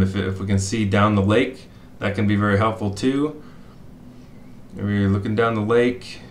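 normal talking voice

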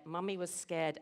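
plain reading voice

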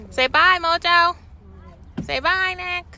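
high, loud, slow sing-song coaxing. Real, not acted. Mom coaxing her baby to say bye, high sing-song.

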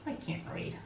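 very soft, near-whispered sigh; hushed mock despair. Reader faced with a textbook, in hushed mock despair. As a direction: breathe it out, barely voiced. Forgetting how to read, sighing.

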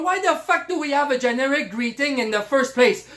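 usual speaking voice

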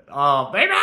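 swooning squeal, rising at the end; giddy squeal. Real, not acted. Calling out to a soulmate, giddy squeal. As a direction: squeal it out, lovestruck.

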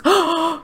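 high, sharp intake of breath; excited gasp. Real, not acted. Player learning a foal is born, excited gasp. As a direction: gasp at sudden good news.

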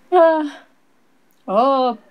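ordinary talk, nothing marked

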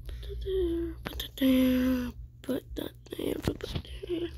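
hushed whisper to herself. Real, not acted. Muttering while placing something in game.

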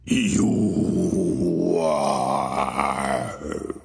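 low mock holler; ghost hollering. Ghost seizing the wife, low mock holler. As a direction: grab her with a low booming shout. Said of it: "the voice hollered".

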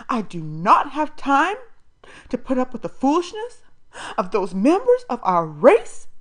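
high, loud, righteous lecturing; haughty foster mother. A narrator's reading. Foster mother condemning the boy, loud and lofty. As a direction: talk down to him as if preaching.

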